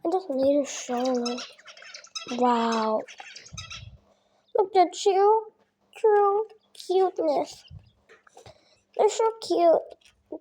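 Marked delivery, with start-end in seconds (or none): 4.6-10.4 cooing. Real, not acted. Cooing at her pet quails.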